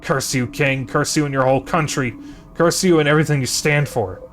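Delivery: furious curse. Acted. Hero curses the king and his country, furious. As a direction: spit the curse at him.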